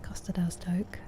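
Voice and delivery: soft, hushed aside; whispered joke. Real, not acted. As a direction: breathe it as a private joke. Murmured joke place name.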